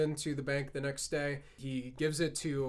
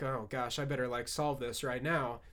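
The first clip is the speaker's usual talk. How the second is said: nervous; 4 semitones lower, 6 dB quieter than usual. Retold or imitated. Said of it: "Quigley is nervous. He's like".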